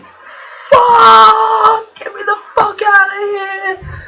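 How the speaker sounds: very high, very loud panicked shriek; terrified scream. Retold or imitated. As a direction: scream in pure terror, trying to escape. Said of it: "I was like".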